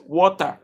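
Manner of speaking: "water" said as wɔta, no r, loud and clear; water, his way. Shown as a demonstration. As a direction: say "water" as "wota", open o, dropped r. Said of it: "let me pronounce it".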